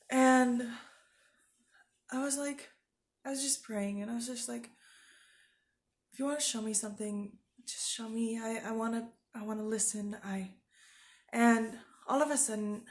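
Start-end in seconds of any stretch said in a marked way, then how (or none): 6.1-10.2 hushed, much softer, pleading to God; soft retold prayer. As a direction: murmur the prayer as if alone with God. Quoting her own quiet prayer.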